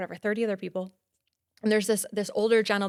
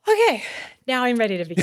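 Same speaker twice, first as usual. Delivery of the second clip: relieved okay; 2 semitones higher, 5 dB louder, faster than usual. Retold or imitated. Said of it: "I took a deep breath".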